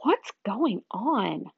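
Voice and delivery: plain reading voice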